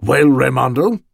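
loud, harsh demand for a report; harsh question. A narrator's reading. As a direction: press him harshly for an answer. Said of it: "asked harshly".

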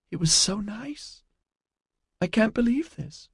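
fragile, high-pitched disbelief; tearful distress. A narrator's reading. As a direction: squeeze it out small and high, near tears. April dabbing her eyes, distraught.

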